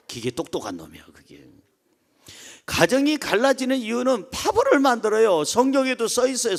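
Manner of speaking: ordinary talk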